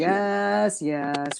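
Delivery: weary, irritated yes, dropping away on the second; frustrated, annoyed, tired. Shown as a demonstration. Director showing the line an actor had to say, frustrated and tired. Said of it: "Frustrated, annoyed, tired, yes".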